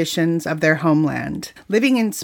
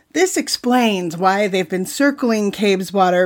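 normal talking voice

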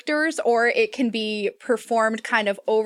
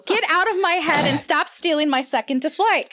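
higher-pitched playful scolding; playfully aggrieved, pitch raised; mock indignation; 6 semitones higher than usual. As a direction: tell them off in mock outrage. Mock-scolds co-host for stealing point.

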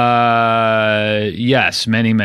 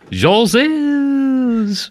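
put-on very high, long-held French-accented word. Co-host asked for the accent, hammed-up French accent. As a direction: stretch "Joses" high in a silly French accent. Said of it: "do you want the accent".

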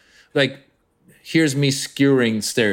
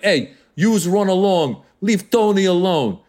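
Italian mob boss; 6 semitones higher than usual. Acted. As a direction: lean into a hammed-up Italian mob boss accent. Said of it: "main mob boss is like".

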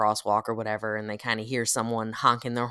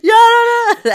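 much higher, much louder through laughter; laughing; 15 dB louder than usual. Real, not acted. Reacting to a friend's story, laughing. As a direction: squeal with laughter.

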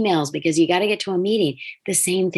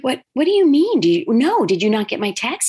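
retold surprise; 5 semitones higher than usual. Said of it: "I'm like".

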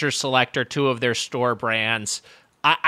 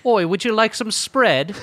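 hammed-up Cockney, higher and slow; mock British accent; 6 semitones higher than usual. Co-host mocking Brits over cream cheese spreads, hammed-up British accent. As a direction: take the mickey with a broad British accent.

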